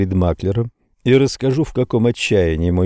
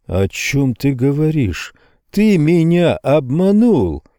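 slow, higher-pitched indignant accusation; nearly shouting; 5 semitones higher, slower than usual. A narrator's reading. Salesman accuses his trader of cheating, almost shouting. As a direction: accuse him, stretching the words, close to yelling. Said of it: "Я орал уже почти в голос".

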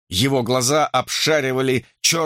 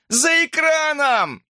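high, slow, drawn-out shout; yelling; slower than usual. A narrator's reading. Hamster directing searchers to the van, yelling. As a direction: stretch the call high to reach rescuers. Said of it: "завопил хомяк".

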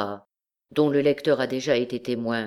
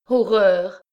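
high, loud cry of horror; a young girl's horrified shout; 6 semitones higher, 6 dB louder than usual. A narrator's reading. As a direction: scream it out, face hidden in hands. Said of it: "cria la jeune fille".

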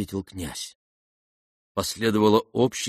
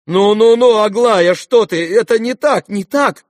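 high, loud, panicky protest; frightened babble; 12 semitones higher, 10 dB louder than usual. A narrator's reading. Father tries to stop Aglaya, frightened. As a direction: blurt it out in alarm. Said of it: "испуганно бормотал Иван Фёдорович".

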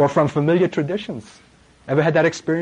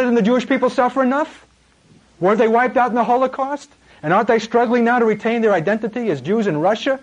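mock accuser; 7 semitones higher than usual. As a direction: hurl the charge of anti-Semite at him. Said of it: "what you're gonna hear".

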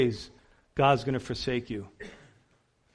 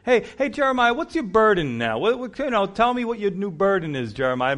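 higher, taunting singsong jeer; mocking Jeremiah; 6 semitones higher, 3 dB louder than usual. Scoffers taunting Jeremiah about his burden, mocking. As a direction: needle him like a heckler mocking a prophet. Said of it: "Mocking him".

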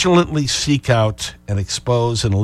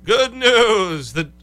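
rising mock chant of "Good news"; mocking repetition; 8 semitones higher, much slower than usual. Host mocks the network's line, climbing in pitch. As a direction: hammer "Good news" higher each time. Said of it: "would emphasize the same point".

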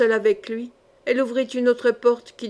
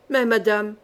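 usual speaking voice

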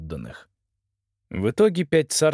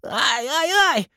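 whimpering; 3 dB louder, slower than usual. Acted. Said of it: "запричитал он".

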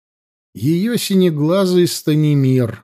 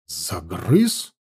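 lower, softer puzzled echo; surprised echo; 8 semitones lower, 5 dB quieter than usual. A narrator's reading. Chief echoing the princess's charge, mild surprise. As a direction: repeat the word in mild disbelief. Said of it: "удивлённо повторил вождь".

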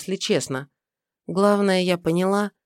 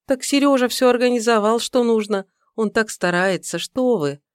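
put-on young woman voice; 6 semitones higher than usual. Secretary defending Seryozha.

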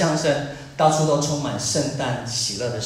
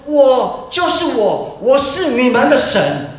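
loud, high, fervent preaching voice; slowed, booming declarations from the pulpit; preaching at full force; 9 semitones higher, 7 dB louder than usual. Acted. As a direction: proclaim it like a preacher crying out God's words. Preacher voicing God's call.

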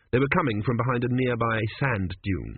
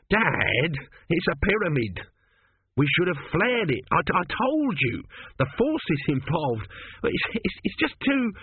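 put-on higher, nervous young son's voice; fretful son stumbling over his words; anxious son; 6 semitones higher than usual. A narrator's reading. Son frets to his father about the pyramid, higher and nervous.

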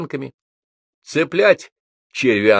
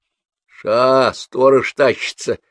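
put-on louder hushed warning to the boys; 4 semitones higher, 4 dB louder, slower than usual. A narrator's reading. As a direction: warn the gang the guard is coming. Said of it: "предупредил Лёнька Жмых". (предупреждение, громче)

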